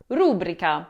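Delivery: rubrica mispronounced as RU-bri-ca; first-syllable stress. Shown as a demonstration. Teacher showing what many Italians say, rubrica stressed on RU. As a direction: punch RU in rubrica. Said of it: "accent on that first syllable".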